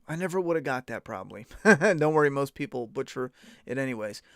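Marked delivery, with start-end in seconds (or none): none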